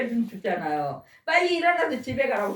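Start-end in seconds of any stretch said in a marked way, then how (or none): 1.2-2.5 higher-pitched scolding call; lightly voiced scolding shout; retold yell. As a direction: order him home with a raised voice. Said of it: "소리 깨 지르면".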